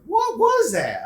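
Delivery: slow, loud, high "What was that"; said aloud. Retold or imitated. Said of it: "I said".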